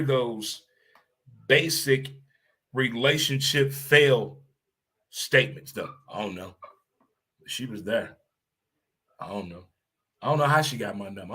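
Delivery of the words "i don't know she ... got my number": mocking a dodging player.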